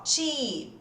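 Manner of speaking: "cheap" with a long ee, said slow and clear; model pronunciation. Shown as a demonstration. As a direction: stretch the ee in "cheap". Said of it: "A primeira foi mais ou menos isso". ("cheap" bem pronunciado, com I longo)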